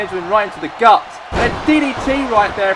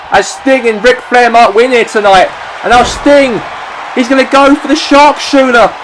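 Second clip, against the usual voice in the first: higher and louder, calling the finish; excited shouting; 7 semitones higher, 7 dB louder than usual. Acted. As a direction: build to the tap-out call. Match finish called at full force.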